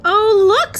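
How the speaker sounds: loud, high delighted exclamation; excited cry. Acted. The class spotting Miss Nelson at the door, loud and high with delight. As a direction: burst out with joy.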